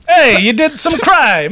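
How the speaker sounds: broad Bronx impression; mock Bronx accent. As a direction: drawl it like a hammed-up Bronx vigilante. Resumes the Batman sidekick impression.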